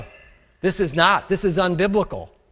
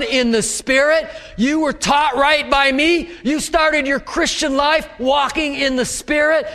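preaching; 7 semitones higher than usual. Acted. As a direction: build the rebuke to full force. Preaching Paul's rebuke.

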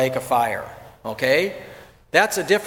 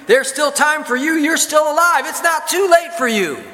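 loud, high hellfire preaching shout; fiery country preacher yelling at a funeral; preacher pounding the pulpit; 10 semitones higher, 4 dB louder than usual. Retold or imitated. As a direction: thunder it at the mourners from the pulpit. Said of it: "the preacher's pounding on the pulpit".

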